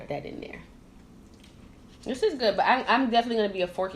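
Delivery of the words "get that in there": whispering. Real, not acted. Quiet aside while serving food.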